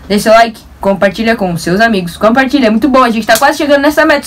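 ordinary talk

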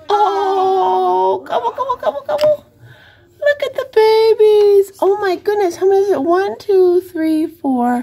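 high, delighted counting; excited. Real, not acted. Counting baby turkeys excitedly.

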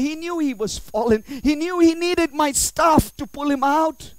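loud, high sermon crescendo; full-force preaching. Acted. God lamenting his son David, loud impassioned preaching.

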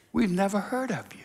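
soft, half-whispered dismissal; hushed snub. Acted. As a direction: dismiss him under your breath. Heaven's voice snubs him again.

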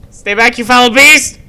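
high, very loud warding cry; mock-heroic shout. Player wields a chair at a monster, mock-heroic shout. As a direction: bellow at the monster in mock bravery.

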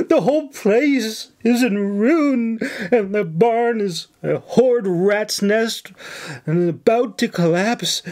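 high, fretful mock lament; hammed-up distress. A narrator's reading. Nora working up the governor with fake woe. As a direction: pile on the misery.

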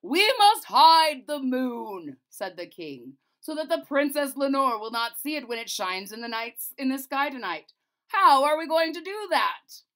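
the king demands a plan loudly; commanding king. A narrator's reading.

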